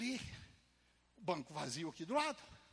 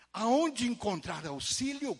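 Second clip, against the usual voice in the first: emphatic reading; 6 semitones higher, 9 dB louder, much slower than usual. Acted. Reading the Bible's index aloud.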